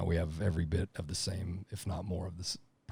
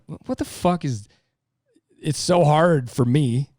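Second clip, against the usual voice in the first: higher, disbelieving exasperation; exasperated outburst; 8 semitones higher, 9 dB louder, slower than usual. Retold or imitated. Lineman venting over petty home arguments, exasperated. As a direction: throw up your hands in disbelief.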